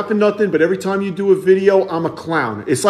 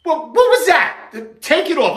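normal talking voice

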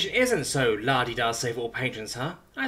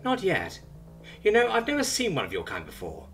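higher, breezy questioning tone; casual interrogation; 8 semitones higher than usual. Acted. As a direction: probe him coolly, unbothered. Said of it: "Simone interrogates".

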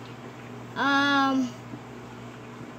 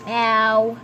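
low-pitched pretend meow; cat's meow; 8 semitones lower than usual. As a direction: meow low as a toy cat. Plays the cat.

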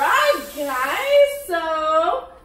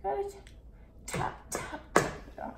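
whispered; 3 semitones higher, 10 dB quieter, much faster than usual. Real, not acted. Hissed instruction.

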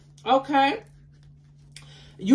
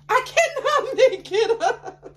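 high, laughing through the words; can't contain the giggle; giddy laugh mid-line; 13 semitones higher, 4 dB louder, slower than usual. Real, not acted. Giddy tarot reading, laughing.

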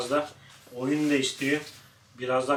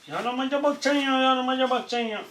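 pet talk; 10 semitones higher than usual. Real, not acted. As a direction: coo at the naughty dog. Baby talk to the dog.

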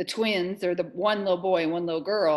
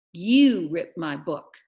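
YOU drawn out and loud; slow accusation leaning hard on "You"; pointed accusation; 10 dB louder, slower than usual. Acted. Amanda blames Mars Bar, pointed accusation on YOU. Acted quarrel over torn book.

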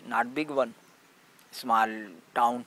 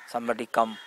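ordinary talk

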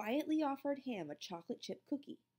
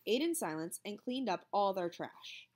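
usual speaking voice

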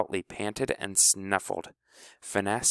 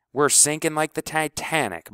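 moaning; 8 semitones higher, 3 dB louder than usual. A narrator's reading. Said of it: "moaned Clebo".

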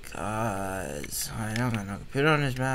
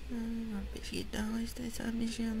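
whispered aside; 7 semitones higher, 5 dB quieter than usual. Real, not acted.

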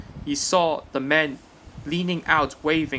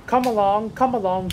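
fussy, hurried urging, pitched higher; fussing; 7 semitones higher, 4 dB louder than usual. A narrator's reading. Thomas fussing at his coaches to hurry. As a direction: chivvy them along fussily. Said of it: "he fussed".

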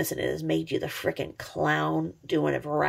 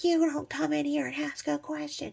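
higher scolding mock voice; mocking the YouTuber; 7 semitones higher, 4 dB quieter than usual. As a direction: parody her lecturing. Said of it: "She's like".

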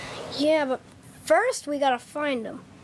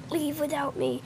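normal talking voice

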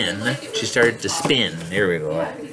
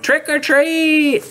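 high, stretched storybook holler; yell; 14 semitones higher, 3 dB louder, much slower than usual. Acted. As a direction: call it out high and drawn out. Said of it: "And she yelled".

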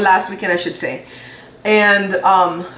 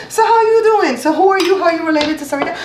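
bubbly party greeter; 8 semitones higher than usual. Retold or imitated. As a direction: play the friendly guest working the room. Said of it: "I'm gonna get up and go".

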